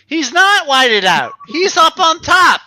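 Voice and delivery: emphatic. Real, not acted. Arguing about the picture.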